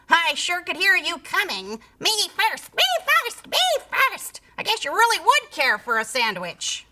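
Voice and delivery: high, teasing sing-song mimicry; mock the pig's squeal; mocking creature. A narrator's reading. Sandwich creature teasing the pig, mocking. Creature mocks his shouts.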